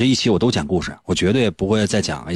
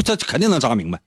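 usual speaking voice

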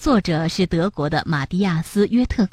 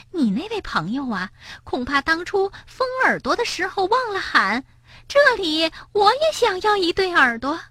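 higher sly murmur, lightly voiced; muttering; 10 semitones higher, 4 dB quieter than usual. Suqi mocking the frog into Bengbeng's ear, sly. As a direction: murmur the joke into his ear. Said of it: "叽里咕噜地说".

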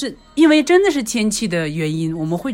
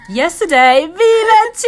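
excited; 11 semitones higher, 8 dB louder than usual. Real, not acted. Excited about Disney trip.

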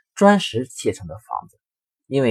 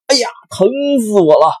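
much higher, loud pained yell; wolf howling in pain; 12 semitones higher, 11 dB louder than usual. Acted. Wolf hit by the rebounding hammer, shrieking in pain. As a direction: scream as the hammer hits. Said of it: "大灰狼大叫".

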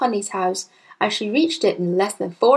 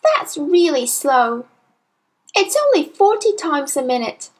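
put-on eager child Matilda; 6 semitones higher than usual. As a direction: share the fact like a keen child. Voices young Matilda.

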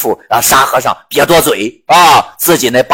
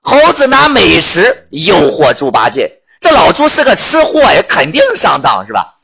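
ordinary delivery, nothing marked

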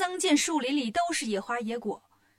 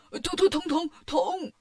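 pained yelp; 4 semitones higher than usual. A narrator's reading. Bajie's ear is being pulled, comic pained yelping. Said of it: "八戒连忙喊".

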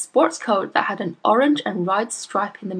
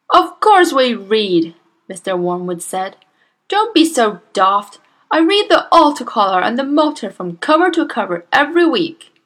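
defensive father; 4 semitones higher, 6 dB louder than usual. A narrator's reading.

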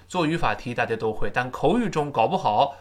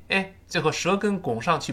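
ordinary delivery

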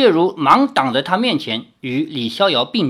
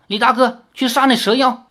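higher called instruction; calling out; 6 semitones higher than usual. A narrator's reading. Lin Yueru shouts to Li Xiaoyao mid-fight, calling. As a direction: call across the fight to him. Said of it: "林月如大叫".

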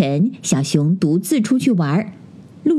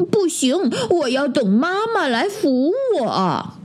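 put-on high, drawn-out childish refusal; mock-tearful little bear, high-pitched; sulky bear cub; 7 semitones higher than usual. A narrator's reading. Bear waits for mum to help, high childish whine.

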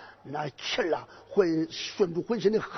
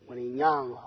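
startled cry; 8 semitones lower, 9 dB quieter than usual. Acted. General startled by boy.

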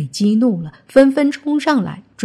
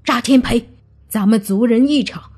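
higher, heated warning; cursing threat; 4 semitones higher, 3 dB louder than usual. Acted. Son with a machete threatening his clansman, angry. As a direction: warn him off with rising anger. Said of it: "指着他的鼻子骂道".

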